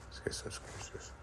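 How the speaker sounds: hushed, voiceless whisper; whispered aside. Real, not acted. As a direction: whisper it privately to your helper.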